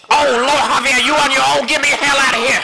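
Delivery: panicked pleading. Acted. Fleeing scared from the rat.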